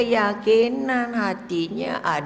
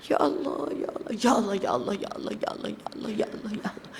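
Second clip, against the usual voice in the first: fast ya Allah sinking to a whisper; rushed zikir fading to whisper; 3 semitones lower, 9 dB quieter, faster than usual. Shown as a demonstration. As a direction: rattle off the zikir and let it die away. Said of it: "tak boleh laju-laju".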